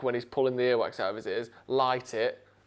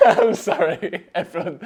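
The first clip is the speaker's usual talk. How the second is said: laughing apology; 4 semitones higher, 7 dB louder than usual. Real, not acted. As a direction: giggle through the apology. Laughing after screaming at listeners.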